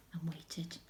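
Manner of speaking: very soft whisper; whisper, holding breath; hushed waiting. A narrator's reading. Boy waits in the dark.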